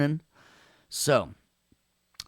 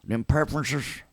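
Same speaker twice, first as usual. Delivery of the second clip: higher-pitched Nashville twang; Nashville accent; 6 semitones higher than usual. Real, not acted. Teacher quizzing the class, slipping into Nashville accent. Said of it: "slipping back into my Nashville accent".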